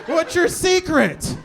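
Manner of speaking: loud, high, slow gushing amazement; gushing praise. Retold or imitated. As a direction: rave over him like a fan. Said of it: "they're like".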